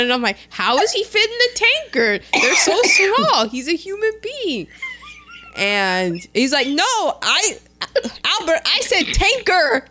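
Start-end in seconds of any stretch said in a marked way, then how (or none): 0.5-4.7 high-pitched, baffled protest; comic incredulity, pitched way up; mock incredulous. Acts out baffled reaction. 6.7-9.9 high-pitched, exasperated correction; mock-frustrated and insistent; acted exasperation.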